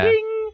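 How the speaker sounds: high ringing "ding" by voice; imitated door chime. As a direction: sing out a shop bell ding.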